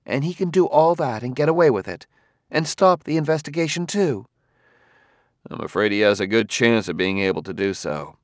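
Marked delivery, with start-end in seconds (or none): 0.0-4.3 higher voice, mildly indignant; Gladia, indignant. A narrator's reading. Gladia protests to Bailey about his opponent, indignant. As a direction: lift it high in protest.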